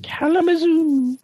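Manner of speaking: very high, drawn-out sing-song. Pretend. Excited reply to concert city.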